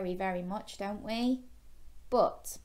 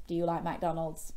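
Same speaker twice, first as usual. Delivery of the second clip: plain reading voice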